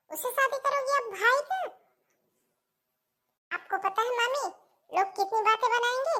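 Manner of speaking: high-pitched, incredulous protest; appalled objection. Acted. Puja confronting aunt over her brother's bride, appalled. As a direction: challenge the absurd match, voice climbing.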